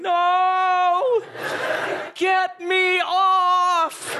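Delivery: high, slow, crying shout; a scared child begging to stop; terrified kid. Retold or imitated. Son on the dark roller coaster, terrified crying shout. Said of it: "And he's going".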